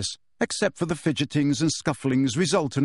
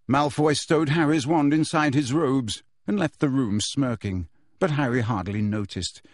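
plain reading voice

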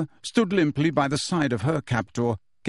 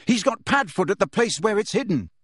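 desperate shout; 7 semitones higher than usual. A narrator's reading. Harry continues shouting.

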